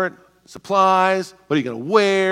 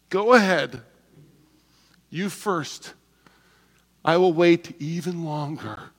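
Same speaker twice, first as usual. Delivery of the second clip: fake politeness; slower than usual. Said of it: "and you're like".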